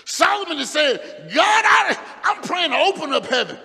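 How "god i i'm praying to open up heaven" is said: pleading shout. Acted. Preacher as Solomon pleading with God, shouted. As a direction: beg heaven out loud.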